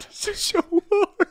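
high laughing complaint; laughing strained. Retold or imitated. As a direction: laugh through a whine. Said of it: "went to the bathroom and was like".

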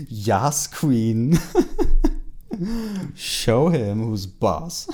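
loud, laughing cheer, last words slow. Real, not acted. Reader cheering Bella on, loud laughing cheer. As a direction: egg her on through laughter.

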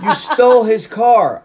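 loud, high, drawn-out accusation; mock outraged yell. Acting out a woman's mood swing.

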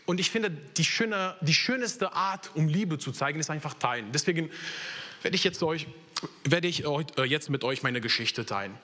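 ordinary talk, nothing marked

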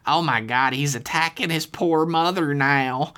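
high, slow melodramatic woman; scandalized, drawn-out high voice; appalled aunt. A narrator's reading. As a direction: bewail it in a put-on aunt's voice. Aunt character bewails the scene.

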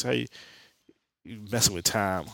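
ordinary talk, nothing marked

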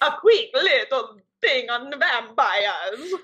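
put-on slow, drawn-out vampire accent. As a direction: ham up a Dracula voice. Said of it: "do a vampire voice".

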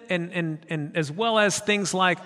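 ordinary talk, nothing marked

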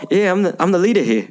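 playful Korg voice; Korg impression. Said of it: "and he's like".

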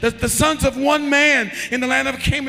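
loud, high, driving preacher voice; preaching in full force. Acted. Preacher retelling the brothers' plea, loud and high. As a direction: shout the scripture out to the church.

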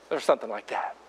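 ordinary talk, nothing marked

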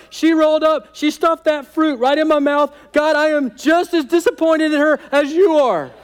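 high, loud, whining blame; whiny Adam. Acted. Preacher acting Adam pointing at Eve before God. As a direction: tattle like a kid caught red-handed.

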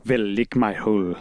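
put-on low, botched German accent; failed German accent that drifts toward Italian; attempted German accent. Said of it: "Seems like it should be a German accent".

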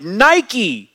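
high, loud herald's cry; retold shout. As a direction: burst out with it, imitating a messenger's victory shout.

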